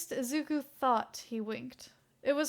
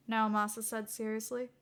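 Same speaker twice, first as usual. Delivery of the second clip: ordinary delivery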